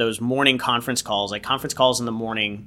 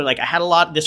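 ordinary talk

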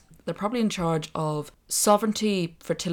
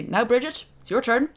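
high, louder put-on Irish accent of uncertain origin; a vague put-on accent, pitched up; unplaced mock accent; 7 semitones higher, 5 dB louder than usual. Nun to Saint Bridget, in an unplaced mock accent. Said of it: "I don't know what accent I was doing there".

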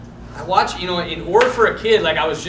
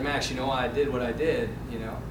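low, soft retold explanation; gentle dad; 7 semitones lower, 9 dB quieter than usual. Dad in son's room explaining discipline, softly imitated. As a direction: imitate a father calming his son. Dad comforts son in bedroom.

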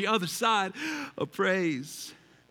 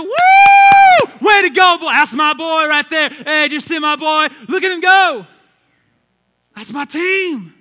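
shouted, much higher and louder whoop; proud parent cheering; 9 semitones higher, 18 dB louder than usual. Retold or imitated. Pastor acting a parent cheering a touchdown, excited shout. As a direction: cheer your boy like a proud dad. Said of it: "the moment deserves a celebration".

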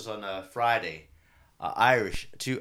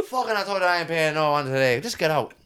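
angry; 5 semitones higher, 5 dB louder than usual. Retold or imitated. Drunk man arguing with woman.

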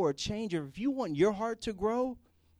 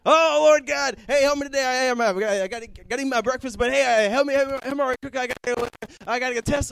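rushed, loud, higher-pitched prayer tumbling out; mock hasty prayer, louder and higher; too hurried prayer; 7 semitones higher, 11 dB louder, faster than usual. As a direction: gabble it like you're late for breakfast. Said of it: "we got the too hurried prayer".

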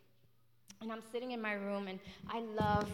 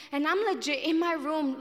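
louder, higher, building; 5 semitones higher, 7 dB louder than usual. Real, not acted. Preacher building intensity.

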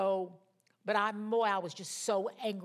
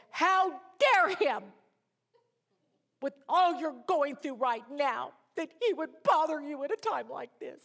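dramatic indignation; 7 semitones higher, 6 dB louder than usual. Retold or imitated. Drama queen to sympathetic friend on the phone, hammed-up indignation. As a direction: play the drama queen, wailing in outrage. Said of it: "I'm very dramatic".

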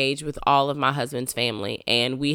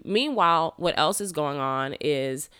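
ordinary talk

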